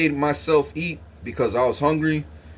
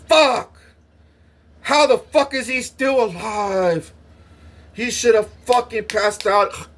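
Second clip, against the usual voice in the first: much higher, louder shocked outburst; shocked nurses; 7 semitones higher, 3 dB louder, slower than usual. Retold or imitated. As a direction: gasp in disbelief he is alive. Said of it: "the nurses are like".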